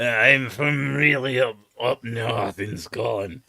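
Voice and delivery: slow, broad highland Scots; a mock highland Scottish accent; northern Scottish accent. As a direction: speak like someone from far up north in Scotland. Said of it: "You get the more like".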